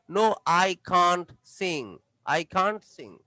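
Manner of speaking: "can't" stretched long, very slowly. Shown as a demonstration. Teacher drilling negative answers: slow drawn "can't". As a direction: draw out both answers evenly. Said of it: "একই রকমের দুইটা উচ্চারণ হবে".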